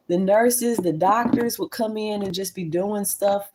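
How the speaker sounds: ordinary talk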